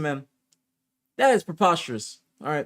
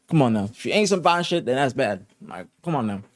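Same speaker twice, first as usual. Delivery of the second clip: ordinary delivery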